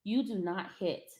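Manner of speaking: calm but no-nonsense, holding his eyes; quiet firm correction. Real, not acted. Mother quietly scolding her son for hitting, hushed and firm.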